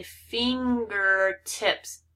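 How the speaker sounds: "fingertips" slowly, split into fin-ger-tips; syllable by syllable. Shown as a demonstration. Teacher going back over multiple syllable words, syllable by syllable. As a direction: draw out each syllable of "fingertips". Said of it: "go back over these multiple syllable words".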